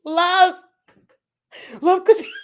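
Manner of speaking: high, loud words through laughter; trying to talk while cracking up; laughing. Real, not acted. Asking a viewer something while laughing hard.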